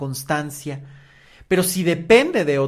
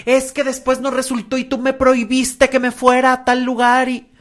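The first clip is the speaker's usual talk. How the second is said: high, loud complaining accusation; imitated resentful reproach, pitched up and insistent; acted reproach; 9 semitones higher, 8 dB louder than usual. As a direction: throw it at your partner as a grievance. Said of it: "y vivas en un reclamo".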